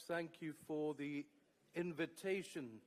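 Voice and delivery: ordinary delivery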